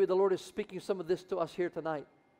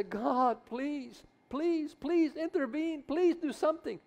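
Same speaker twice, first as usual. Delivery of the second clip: high, pleading begging; pleading prayer; 7 semitones higher, slower than usual. Pretend. Believer in the prayer closet begging God, high and pleading. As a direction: beg God, voice pitched up. Praying in a family crisis.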